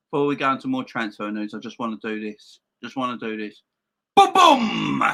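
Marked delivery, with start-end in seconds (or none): 4.2-5.0 loud, higher hype call; excited burst. Real, not acted. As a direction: punch out the boom like a fanfare. Launching his big announcement.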